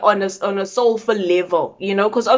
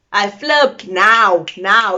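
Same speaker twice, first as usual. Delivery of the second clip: slow emphatic; much slower than usual. Real, not acted. Emphatic self-description.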